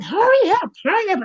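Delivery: high, pleading hurry up; impatient urging. Retold or imitated. Pacing by the phone, high impatient urging. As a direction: beg the phone to ring, pitched high.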